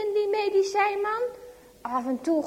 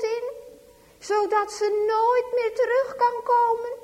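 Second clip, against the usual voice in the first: frightened; 6 semitones higher, 4 dB louder than usual. A narrator's reading. Frightened girl's panicked questions.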